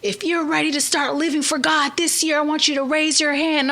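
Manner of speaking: high, ringing altar call; preacher's call. Retold or imitated. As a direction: call the room to respond. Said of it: "The setup was".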